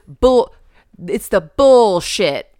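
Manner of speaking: loud, drawn-out Texan-style cussing; slow Texan drawl, hit hard; imitated Texan. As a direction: stretch it like a mock Texan. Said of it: "the way he says bullshit".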